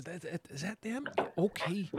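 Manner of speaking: hushed, stammering check on who's there; Harold. Acted. Harold spotting the group, softly hesitant. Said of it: "looks around and sees you guys".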